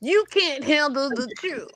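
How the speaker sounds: high, intense courtroom quote; movie line impression. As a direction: belt out the famous movie line.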